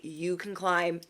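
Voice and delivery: soft, polite brush-off to someone you're dropping; sweetly dismissive. Retold or imitated. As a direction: say it nicely with a bow on it. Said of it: "give them an inhaler with a bow and say".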